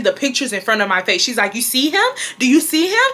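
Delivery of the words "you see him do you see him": higher, rising mock questions; mother's retold taunting. Mom showing the boy's pictures, taunting daughter. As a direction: imitate a parent dangling something forbidden. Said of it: "taunting me at this point".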